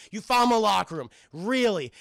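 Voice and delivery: mock motherly disbelief; disbelieving mom. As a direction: challenge a kid's flimsy excuse, loudly. Acted mom disbelieving son's excuse.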